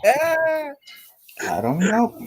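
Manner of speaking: words slowed and stretched by laughter; laughing through the words. Real, not acted. Laughing at the mix-up.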